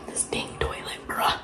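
whispered grumble. Real, not acted. Mom whispering a complaint so the girls sleep. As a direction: mutter it under your breath. Whispered aside late night.

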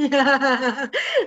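real amused cackle; laugh. Real, not acted. Laughs after acting the reply.

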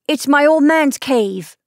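loud, high exclamation; child crying out. A narrator's reading. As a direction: call it out eagerly as a child. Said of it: "cried Tati".

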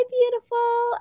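sing-song greeting. Pretend. Reads a fan greeting playfully.

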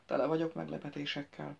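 plain reading voice

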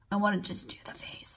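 quick whispered aside; whispering. Real, not acted. Quiet aside while applying.

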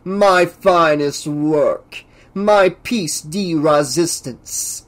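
very slow, self-admiring professor; grandiose scientist, savoring. Acted. Professor glories in his coming experiment, grandiose. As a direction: savor each word pompously.

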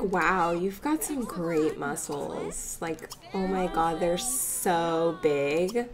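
slow, low, soft admiration; mock swooning. Admirer flattering someone's great muscles, slow mock swoon. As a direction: draw it out as a hammed-up flirt.

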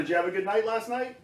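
ordinary delivery, nothing marked

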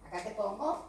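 ordinary delivery

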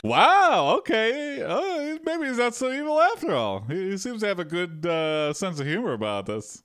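high, fast amazed exclamation; surprised. Real, not acted. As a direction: caught off guard, voice jumping up. Surprised by the king's generosity.

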